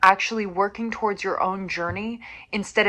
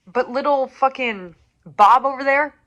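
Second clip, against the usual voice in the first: scornful call-out; 5 semitones higher, 6 dB louder than usual. Real, not acted. Mocking imagined critic.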